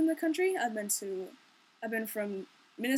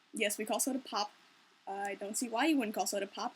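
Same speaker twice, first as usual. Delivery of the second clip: ordinary talk, nothing marked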